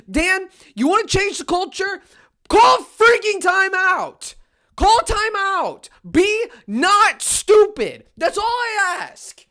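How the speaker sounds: loud, high, slow and deliberate; pleading shout. Real, not acted. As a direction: spell it out to the coach at full volume. Shouting at the coach.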